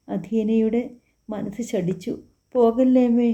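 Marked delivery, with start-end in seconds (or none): 2.5-3.3 louder, pleading cry to her mother; sobbing plea. A narrator's reading. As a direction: beg her mother not to go, loud and tearful. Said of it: "അവൾ ഏങ്ങിക്കരഞ്ഞ് പ്രാർത്ഥിച്ചു". (അമ്മയോട് പോകല്ലേ എന്ന് ഉറക്കെ കരഞ്ഞു കെഞ്ചുന്നു)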